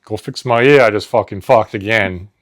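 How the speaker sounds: loud, hammy Jersey Italian workers; Jersey Italian tough guys. Acted. Two grocery workers bonding, loud hammy Jersey Italian accent. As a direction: ham it up as gabagool-loving tough guys. Acting out supermarket Jersey skit.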